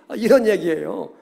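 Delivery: usual speaking voice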